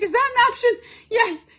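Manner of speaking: high, strained plea to stop; high desperate surrender; desperate pleading. Retold or imitated. Begging for a break in agony.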